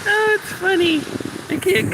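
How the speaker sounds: laughing at herself, shivering on the shore. Real, not acted. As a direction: giggle through the words. Laughing at her own shaking.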